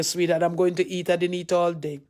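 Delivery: ordinary talk, nothing marked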